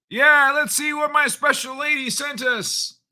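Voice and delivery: put-on falsetto game-plumber voice; high cartoon voice. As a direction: pitch it way up, playfully. Returns to high Mario voice.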